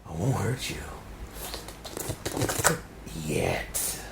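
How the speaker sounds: low whispered threat; menacing whisper. Acted. Villain whispering to his victim, low and cold. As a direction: breathe the threat softly.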